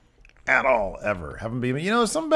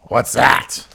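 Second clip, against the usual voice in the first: mock Triple H voice; 3 semitones higher, 5 dB louder than usual. Imitating Triple H and Vince.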